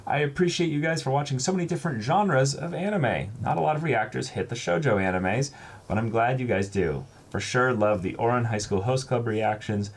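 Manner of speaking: bright read-aloud voice for the viewer's letter; reading a viewer's kind note to the camera. Acted. Reads fan letter aloud.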